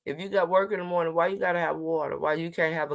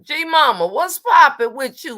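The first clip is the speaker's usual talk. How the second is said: excited greeting; 10 semitones higher, 9 dB louder than usual. Real, not acted.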